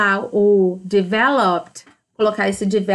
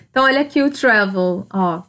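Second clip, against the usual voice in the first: usual speaking voice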